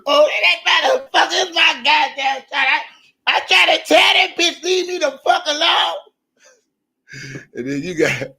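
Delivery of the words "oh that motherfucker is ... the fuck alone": mock ranting lady. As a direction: shout it in her high voice. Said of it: "She was".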